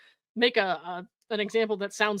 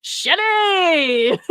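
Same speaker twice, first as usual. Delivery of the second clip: mock-excited shout of a name, higher and louder; enthusiastic scream; 6 semitones higher, 6 dB louder than usual. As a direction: yell her name like an overeager stranger. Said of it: "enthusiastically scream types".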